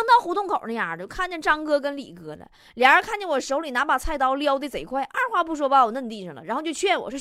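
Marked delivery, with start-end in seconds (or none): none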